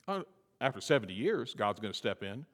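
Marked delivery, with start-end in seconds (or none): none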